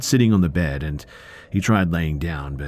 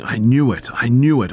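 raised, higher, louder accusing cry; narrated yell, loud and high without a full scream; 8 semitones higher, 7 dB louder than usual. A narrator's reading. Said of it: "He was screaming".

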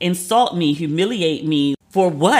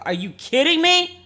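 high, slow, disbelieving "are you kidding me"; sky-high indignant squeal; incredulous outrage; 12 semitones higher than usual. Retold or imitated. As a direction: stretch it out in mock disbelief. Outraged at the hostess's email.

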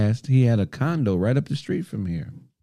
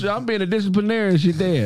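ordinary talk, nothing marked

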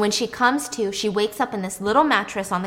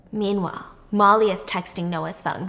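usual speaking voice

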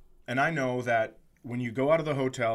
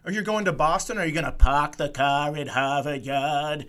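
mock Boston accent; 2 semitones higher, 3 dB louder than usual. Imitating people who hear of Boston trips, hammed-up Boston accent. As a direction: drop the R's in a broad mock Boston accent. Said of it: "it's the equivalent of going".

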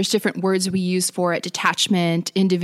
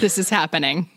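laughing; 2 semitones lower than usual. Real, not acted. Laughing while announcing the topic.

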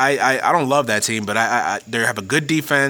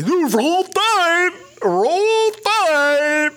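mock fan chant, much higher and drawn out; mocking Alabama fan; much slower than usual. Mocking bandwagon Alabama fans chanting, hammed-up and high. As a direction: ham up an obnoxious fan yelling the cheer. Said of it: "annoying bandwagon-y fan base".

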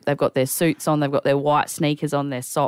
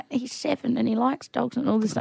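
put-on soft, higher voice for an old man; elderly man; 6 semitones higher, 5 dB quieter than usual. Elderly man describing his grandson, soft and high. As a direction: lightly imitate a gentle old man.

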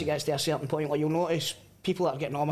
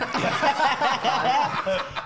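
big laugh; cracking up; loud laughter; 9 semitones higher, 4 dB louder than usual. Real, not acted. Laughs at story.

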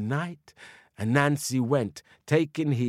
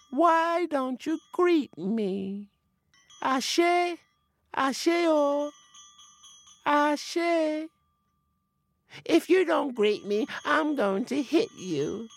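high, drawn-out spirit call; sweet high voice of an unseen forest spirit; faint spirit voice; 11 semitones higher, slower than usual. A narrator's reading. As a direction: call out high and thin, asking for a greeting. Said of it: "He could hear this faint voice".

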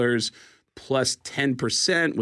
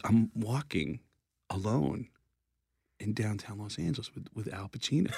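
much softer, halting phrases; hushed awe; 3 semitones lower, 9 dB quieter than usual. Retold or imitated. Comedian friend alone downtown with a star, hushed awe. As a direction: let it drop away between pauses. Retelling Jay's awed account, hushed.

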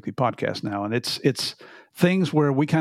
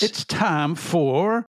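drawn-out announcer; 4 semitones higher, much slower than usual. Acted. Announcing the segment title.

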